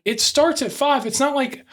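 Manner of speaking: high-pitched exasperated retelling. Retold or imitated. Telling off family running late to the show, exasperated.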